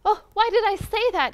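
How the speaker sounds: high, loud, baffled question; startled self-questioning; wondering. A narrator's reading. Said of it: "he wondered".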